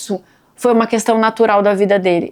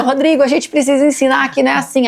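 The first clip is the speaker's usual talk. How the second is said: mock scolding wife; 4 semitones higher than usual. Mother scolding husband, retold.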